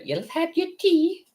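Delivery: high, slow Edinburgh Morningside lady; Morningside lady accent. Acted. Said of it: "Morningside ladies in Edinburgh".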